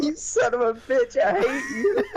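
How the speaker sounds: high-pitched imitated cursing; hammed-up yell of a beaten player; mock outrage. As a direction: imitate a sore loser's outburst. Retold opponent cursing teller after defeat.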